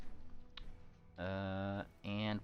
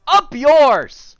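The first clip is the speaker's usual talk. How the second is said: angry shout; 19 dB louder than usual. Acted. Enemy shouts at attacker.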